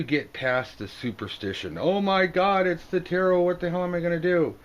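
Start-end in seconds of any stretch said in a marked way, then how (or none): 1.8-4.6 high, loud hammed-up panic; mock panic. Superstitious reader panicking at the tarot, high mock alarm. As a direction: freak out over the tarot card, hammed up.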